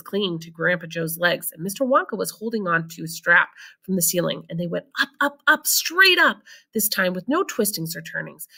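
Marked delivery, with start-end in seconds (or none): none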